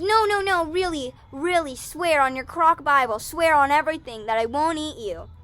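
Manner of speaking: high, loud begging bunny; frantic pleading. Acted. Bunny demanding an oath from crocodile, frantically pleading. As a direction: plead at the top of your register.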